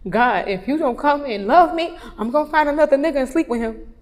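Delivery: reenacted exasperated pleading; demanding prayer. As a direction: hand God an ultimatum.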